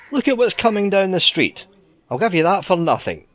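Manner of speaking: Davy Jones tempting. Acted. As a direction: coax him like a sly sailor offering a prize.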